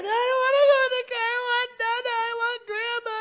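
very high, loud tantrum wail; hammed-up wailing kid who wants Grandma; crying four-year-old. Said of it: "they're crying in the back".

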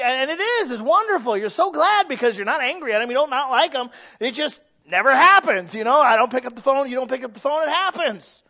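rising from firm to a loud, high peak; preaching at full force. Acted. Preacher building to a loud peak for the congregation.

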